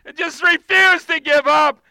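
preaching at full force; fervent preaching. Real, not acted. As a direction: drive the church to its knees. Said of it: "urging the congregation".

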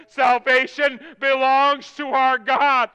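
high, slow, ringing proclamation; loud cry. Acted. Multitude before the throne, crying out loudly. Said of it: "they cried out in a loud voice".

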